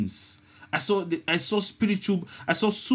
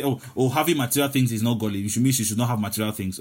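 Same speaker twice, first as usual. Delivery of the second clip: lower, fast mock objection; mocking an objector; 6 semitones lower, faster than usual. Preacher mimicking a listener who misunderstands, lower and fast. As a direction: toss off the misreading, a little lower. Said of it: "still say".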